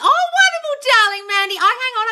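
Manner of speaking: high, loud gushing praise; delighted gush. Real, not acted. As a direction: gush over her good news. Reacting to Mandy's success story.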